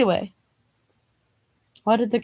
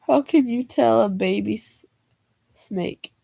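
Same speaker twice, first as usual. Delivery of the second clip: normal talking voice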